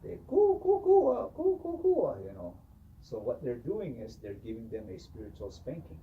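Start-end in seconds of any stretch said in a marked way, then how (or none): 0.3-2.2 very high, loud hooting coos; kachina hooting. Retold or imitated. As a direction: hoot like a whipping kachina. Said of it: "with those willows".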